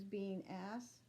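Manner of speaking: usual speaking voice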